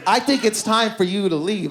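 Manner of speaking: mock-stern voice showing someone the door; insistent dismissal. Woman sending her hookup home, insistent dismissal. She acts out kicking him out.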